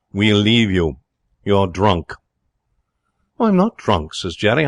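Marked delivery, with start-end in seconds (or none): 0.1-2.1 stiffly dignified pronouncement. A narrator's reading. Pa Tuxton taking leave of Jerry, dignified. 3.4-4.1 high-pitched denial; exalted protest. A narrator's reading. Jerry denying he is drunk, high and exalted.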